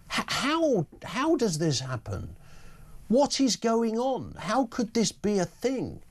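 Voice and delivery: high-pitched disbelief, slowing on what is going on; can't believe what he's hearing; incredulous. Real, not acted. Radio host to listeners, incredulous.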